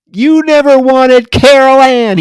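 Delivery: high, loud and slow accusing scream; retold scream. Possessed father screams at his wife, high and loud. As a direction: shriek it at her like a possessed man. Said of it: "he screams".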